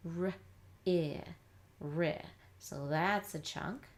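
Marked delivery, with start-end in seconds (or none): 0.1-2.2 "R" and short "I" apart, then blended "ri"; sounded out. Shown as a demonstration. Teacher sounding out the next part, segmented then blended. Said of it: "start sounding out the next part".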